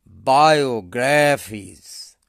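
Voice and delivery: Biographies slow, with the loud weight on গ্রা; stress on graph. Shown as a demonstration. Teacher modelling the stress for students, slow and loud on গ্রা. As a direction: lean hard on the middle syllable গ্রা in biographies. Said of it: "গ্রাফের ওপর উচ্চারণ জোর হবে".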